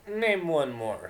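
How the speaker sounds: slow, drawn-out dare; slow taunting demand; challenging. Acted. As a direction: challenge her to go on. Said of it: "she challenged".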